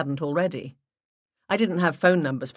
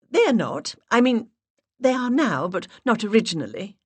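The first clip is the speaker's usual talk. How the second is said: put-on higher and louder, stronger accent; high breathy put-on voice; 6 semitones higher, 5 dB louder than usual. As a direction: voice raised an octave, stronger English accent. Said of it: "I raised my voice an octave".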